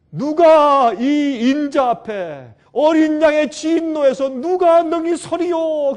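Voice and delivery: high, loud and very slow outcry; crying out. Retold or imitated. Preacher crying out who can stand before the Lamb's wrath. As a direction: cry the question out to the heavens. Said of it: "부르짖었어요".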